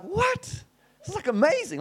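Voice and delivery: high squeal of delighted shock; amazed disbelief. Retold or imitated. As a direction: gasp it out, stunned with joy. Stranger offers free repair.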